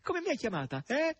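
high mocking yell; high teasing shout. A narrator's reading. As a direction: bark it teasingly at the pig. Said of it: "gridò Natascia".

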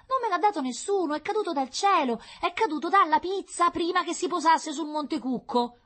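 put-on very high, loud child voice; child insisting on an unlikely truth; protesting little girl. A narrator's reading. Child defends herself from parents' questioning. (difesa acuta da bambina)

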